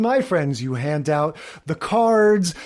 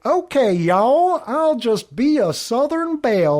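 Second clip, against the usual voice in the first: put-on higher, slow Southern belle accent; 6 semitones higher than usual. Pam gamely playing along, slow Southern belle accent. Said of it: "her sort of sincere little".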